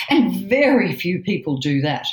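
usual speaking voice

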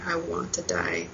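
very low, hushed confession; really emotional, voice dropped far down. Real, not acted. Woman recalling her hospital despair, quietly emotional. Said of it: "I'm sorry, I get, like, really emotional".

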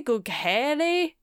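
Gughele drawn out slowly, Italian style; fake Italian accent. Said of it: "a fake Italian accent on it".

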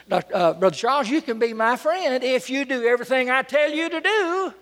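high-pitched presumptuous condition; mock demand. Preacher to a longtime friend, a mock demand. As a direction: lift the pitch and hold it up, overbearing. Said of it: "make a demand like that".

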